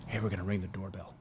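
low hushed report; whispered radio call to the platoon leader. Retold or imitated. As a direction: tell them quietly over the radio. Whispered radio call during raid.

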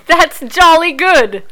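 a slow, hammed-up British "jolly good"; mock British. Cohost hams up a British accent for a UK review.

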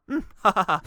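spoken fake laugh. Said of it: "used to laugh as if he was saying".

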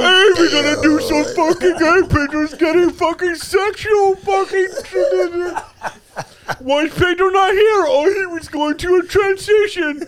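very high, loud mocking squawk; hammed-up high-pitched mockery; mocking imitation. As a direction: mimic the podcast crew in a loud, high caricature. Mocking what the podcast hosts said.

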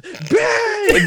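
mock play-by-play bang; Mike Breen bang. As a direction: belt it like a basketball announcer. Imitating Mike Breen's call.

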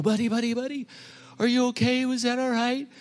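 high, worried rush of questions; worried dad after the ride; anxious checking. Retold or imitated. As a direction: check on him frantically. Dad kneels checking dazed son.

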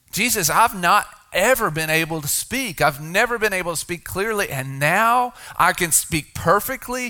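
the healed man begging to be allowed to tell; healed man protesting. Retold or imitated. Preacher voices healed man's protest.

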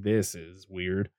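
quiet aside. Retold or imitated. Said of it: "and I was like".

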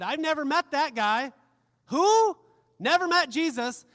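high, loud, incredulous disowning; loud acted denial. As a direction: play someone loudly denying they know the man. Acting out Peter's denial of Jesus.

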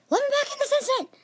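high-pitched demanding yell. Acted. Brother shut outside yelling to sibling, high yell. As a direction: shriek to be let in. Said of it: "my brother yelled".

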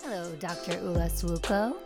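normal talking voice

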